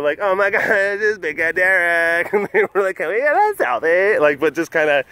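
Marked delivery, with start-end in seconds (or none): 0.2-2.3 slow, higher teasing voice; playful teasing. Retold or imitated. 3.0-4.2 higher mock-impressed teasing. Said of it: "kinda being teasing".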